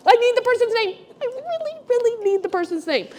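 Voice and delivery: high desperate pleading; upset pleading. Retold or imitated. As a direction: beg for the name, voice climbing. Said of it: "And I'm getting really upset".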